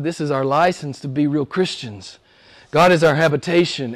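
usual speaking voice

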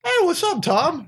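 high-pitched acted hello; re-enacted eager greeting; excited greeting. Greets [name] in story.

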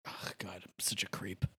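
a real sheepish whisper; embarrassed whisper. Real, not acted. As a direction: hide the words in a breathy hush. Embarrassed aside, drops voice.

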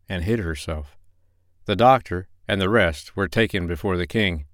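ordinary delivery, nothing marked

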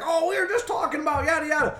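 high, excited retelling; imitated friendly guy. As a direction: chatter high, imitating a delighted acquaintance. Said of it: "He's like".

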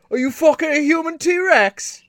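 loud, high-pitched cartoon English accent; hammed-up, indignant bad English accent, shouted; crude English accent. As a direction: do a terrible English yob accent. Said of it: "limited understanding of the English, uh, accent".

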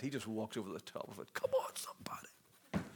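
very soft whispered urging; awed whisper. Real, not acted. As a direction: whisper it to stir the congregation. Awed aside to the congregation.

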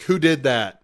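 loud mock demand; imitated regular customer. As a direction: call out like a puzzled regular. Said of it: "come up and be like".

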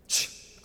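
sharp "shh" hush; voiceless shushing sound; imitated shushing. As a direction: hush someone who is making noise. Disciples hushing the blind man.

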